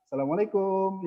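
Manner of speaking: "assalamualaikum" jadi "salamalekum" logat kampung; wrong Indonesian way. Shown as a demonstration. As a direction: contoh yang salah, logat Indonesia dilebur. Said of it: "Jangan assalamualaikum". (logat kampung yang jangan ditiru)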